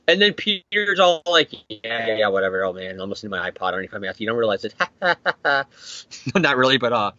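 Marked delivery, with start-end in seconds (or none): 1.7-4.7 low, soft brush-off; mock dismissive. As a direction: tune him out in a low, uninterested murmur. Peter brushes off the old man.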